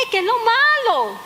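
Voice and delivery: why, what's wrong?; surprised question. Retold or imitated. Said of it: "Le digo". (esposa sorprendida pregunta al esposo, aguda y fuerte)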